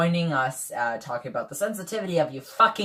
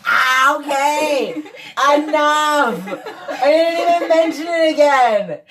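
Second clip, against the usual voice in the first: higher, louder laughing protest; laughing yell; 11 semitones higher, 7 dB louder, slower than usual. Real, not acted. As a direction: yelp it out through laughter. Yelping after being punched.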